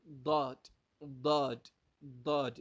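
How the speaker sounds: "ضاد" with a heavy emphatic D; teacher's separate repetitions of emphatic "ضاد"; Arabic Daad demo. Shown as a demonstration. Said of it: "فتنطق به".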